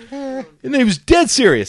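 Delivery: normal talking voice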